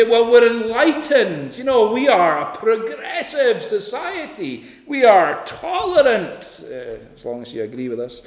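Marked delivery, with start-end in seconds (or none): 0.0-6.6 louder, higher smug voice parodying society; mocking smug society. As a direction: puff it up with mock pride. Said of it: "People say".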